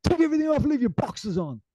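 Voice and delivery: high, loud hammed-up command; mock Goggins order. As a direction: order it like a drill sergeant.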